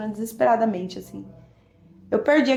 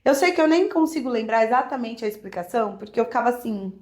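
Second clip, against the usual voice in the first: usual speaking voice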